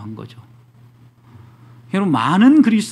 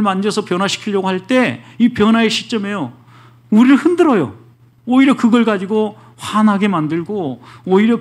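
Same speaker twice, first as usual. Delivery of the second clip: higher, louder, driving sermon voice; preacher building to a loud peak; forceful preaching; 4 semitones higher, 3 dB louder than usual. Acted. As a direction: push the warning out to the pews. Preaching at rising intensity.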